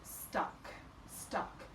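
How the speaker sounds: Stuck whispered softly twice; soft whispered Stuck, listening for sounds. Shown as a demonstration. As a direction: quietly whisper Stuck to yourself. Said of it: "Let me try that one".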